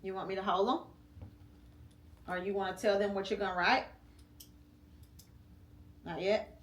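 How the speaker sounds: usual speaking voice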